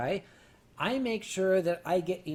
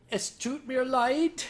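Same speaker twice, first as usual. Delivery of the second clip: slow, higher put-on German sorry; German apology; 6 semitones higher, 3 dB louder, slower than usual. Apologising to the bartender, a slow, put-on German apology. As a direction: recall the phrase with relief, in German. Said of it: "Oh, yes".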